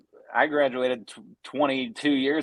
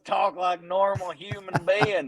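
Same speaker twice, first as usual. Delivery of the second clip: put-on Southern accent; 7 semitones higher than usual. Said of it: "that was the best Southern accent ever".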